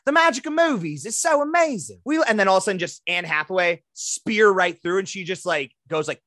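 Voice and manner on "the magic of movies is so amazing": higher, breathy gushing Australian voice; mock Australian accent. Host imitates Margot Robbie in a mock Australian accent. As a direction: gush it like an Aussie starlet at an awards show. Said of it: "this is an accurate, uh, imitation".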